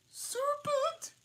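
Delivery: piercing high pigeon shriek. Acted. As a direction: shriek it like an angry bird. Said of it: "that's better".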